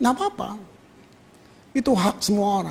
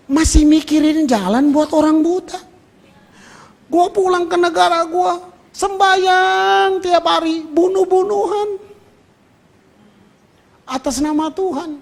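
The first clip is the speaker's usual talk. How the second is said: preaching loudly; 7 semitones higher, 4 dB louder than usual. Real, not acted. Preacher rebuking his own nation to the congregation, higher and louder. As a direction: hammer the contrast home.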